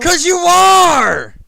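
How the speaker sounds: sudden high shout, slow and stretched. Real, not acted. Friend yelling back at the teasing Australian, loud. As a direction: yell it right back at him.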